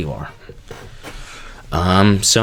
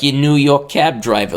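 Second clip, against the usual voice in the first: put-on louder, higher New York cabbie accent; 6 semitones higher, 4 dB louder than usual. Vlogger pitching a cab driver video, New York accent. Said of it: "a New York or, like, Boston accent".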